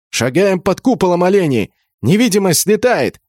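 high, exasperated commands; shouted scolding. A narrator's reading. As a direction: yell a warning at careless troops. Scolding the squad mid-run.

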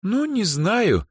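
slow, high, doubtful reply; unsure. A narrator's reading. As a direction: drag it out hesitantly. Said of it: "неуверенно покачала головой Эльза".

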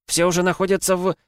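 high urgent shout, cut off. Acted. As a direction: keep yelling, then break off. Huntress still shouting. (громкий крик)

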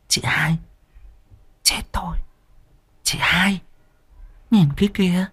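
soft, half-whispered warning; hushed alarm. A narrator's reading. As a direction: whisper it, shocked and secret. Pointing at blood stain secretly.

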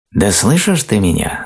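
high, impatient little voice; high-pitched storybook ant, demanding attention; insistent ant voice. A narrator's reading. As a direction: break through to a dreamer. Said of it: "наконец прорвался к медвежонку муравьиный голос".

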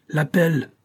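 high ringing shout of "L'appel"; shouted call. A narrator's reading. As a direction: call "L'appel" out across the deck. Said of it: "cria le porte-voix du commandant".

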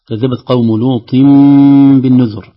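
لوط بالنذر with the tanween turned to a nasal m; نون التنوين in لوط said as a nasal م before الباء; iqlab on lut. Shown as a demonstration. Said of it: "هذا حرف الميم". (نطق لوطم بالنذر بإقلاب التنوين ميمًا)

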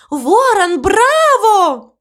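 high, ringing cheer; exclaimed bravo. A narrator's reading. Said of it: "воскликнула мама Му".

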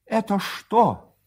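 high, slow, stunned question; choking indignation. A narrator's reading. Husband catching his wife embraced, choking indignant question. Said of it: "задыхаясь".

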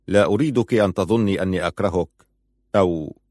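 usual speaking voice

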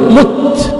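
مت said "mutt"; "mutt", u after the mim of مت; damma on the mim. Shown as a demonstration. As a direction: say مت with a short u. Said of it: "فتكون بالضم".

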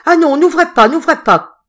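high, loud panicked cry; terrified stammer. Acted. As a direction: beg in terror not to open. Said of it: "bégaya l'aubergiste".

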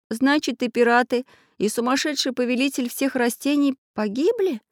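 put-on high boyish voice, a touch of sadness; sad question. A narrator's reading. Boy asks whether the pirates perished, sadly. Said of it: "грустно спросил Прутик".

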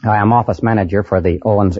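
usual speaking voice

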